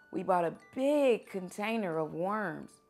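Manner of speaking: plain reading voice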